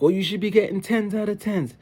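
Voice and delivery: high, loud imitation of a demanding mom; scolding mom. Mom pressing her boy for better marks, imitated scolding.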